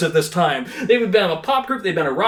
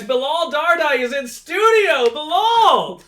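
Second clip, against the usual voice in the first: high, laughing announcer shout; hype shout; 8 semitones higher, slower than usual. Acted. Host shouting the guest's name as he arrives. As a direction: cheer the guest in like a game show.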